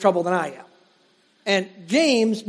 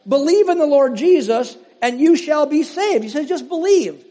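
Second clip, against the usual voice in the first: raised, measured reading of Paul; preacher reading scripture aloud; 5 semitones higher, 4 dB louder than usual. Acted. Reads Acts aloud.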